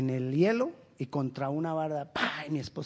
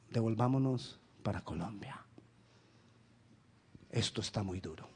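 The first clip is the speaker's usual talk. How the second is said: whispered and much lower, worn out; defeated whisper; 8 semitones lower, 8 dB quieter than usual. Retold or imitated. An exhausted immigrant whispers about going home to Colombia. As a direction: breathe it like a tempting secret thought. Imagined discouraged thought of returning.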